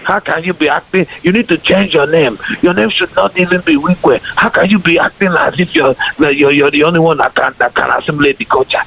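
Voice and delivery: high Nigerian accent, imitating an angry commenter; mock the commenter in a Nigerian accent. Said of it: "I would say it in the Nigerian accent".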